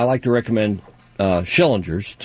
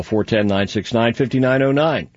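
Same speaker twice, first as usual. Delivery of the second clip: phone number rattled off quickly; quick, unbroken phone number read; too fast. Real, not acted. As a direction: run the digits together without pauses. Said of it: "Did I say that too fast?".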